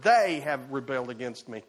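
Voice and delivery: "they" punched out, the rest falling away; stress on THEY. Shown as a demonstration. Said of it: "It's emphatic".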